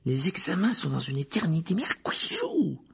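high-pitched, exasperated protest; roaring complaint. A narrator's reading. Simus complaining about teachers' workload, exasperated. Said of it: "rugit Simus Finnegan".

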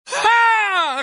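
very high, loud whoop of triumph; triumphant shout. Acted. Ron's triumphant burst of laughter. (cri de triomphe aigu)

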